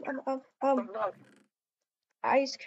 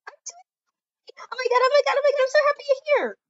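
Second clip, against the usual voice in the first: excited squeal; 11 semitones higher, 5 dB louder, much faster than usual. Acted. Greeting best friend.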